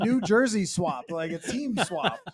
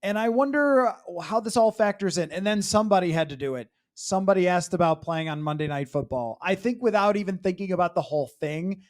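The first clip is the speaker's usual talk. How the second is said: ordinary talk, nothing marked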